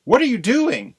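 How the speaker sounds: high, loud, outraged question; shocked mother crow. Acted. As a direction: catch the thief in the act, shrieking. Missus Crow confronts the snake.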